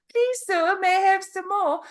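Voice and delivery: playful high English accent, begging politely. Shown as a demonstration. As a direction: plead high and light in a put-on English accent. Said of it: "I could go into an English accent".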